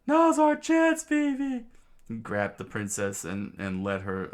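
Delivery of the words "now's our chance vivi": high, loud shriek; screamed out loud. Acted. Lookout shrieking to the princess that their chance has come, loud high scream. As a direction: squeal it out, much higher and louder. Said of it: "screamed out loud".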